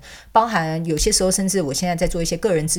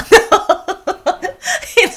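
high, loud burst of laughter; 7 dB louder than usual. Real, not acted. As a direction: crack up out loud. (大笑出声)

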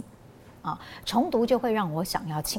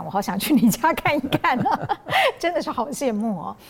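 louder and higher, gushing with a laugh; excited envy; 6 semitones higher, 5 dB louder than usual. Real, not acted. Host hears about the three-storey bookshelf, laughing with excited envy. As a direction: squeal with delight at his house.

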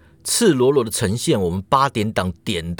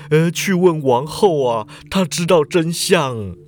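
put-on an old man's louder, slow outburst; 4 semitones higher, 3 dB louder, slower than usual. A narrator's reading. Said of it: "老人就大声说". (老人大声说，缓慢)

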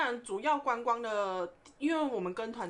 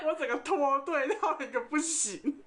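words spilling out through laughter, higher; laughing; 5 semitones higher than usual. Real, not acted. Telling the co-host about ditching the tour group, laughing. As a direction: laugh helplessly at your own mischief.

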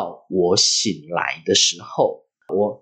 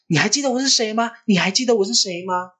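much higher-pitched, insistent questioning; anxious aunt; 11 semitones higher, faster than usual. Retold or imitated. Aunt asking the child in hospital if she is recognised, high and anxious.

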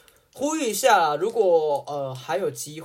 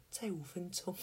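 sleepy mumble; 18 dB quieter than usual. Retold or imitated. Sleepy kid begging more sleep.

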